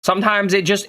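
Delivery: ordinary delivery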